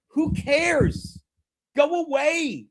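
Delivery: high-pitched, exasperated brush-off; exasperated dismissal. Real, not acted. Streamer dismissing trolls who tweet his address, exasperated. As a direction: wave them off in exasperation.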